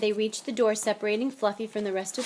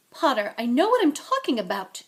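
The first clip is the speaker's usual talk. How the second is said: louder, higher schoolmistress firmness; curt; 4 semitones higher, 8 dB louder than usual. A narrator's reading. McGonagall dismissing Potter's objection, curt. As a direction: cut off the argument firmly. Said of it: "she said shortly".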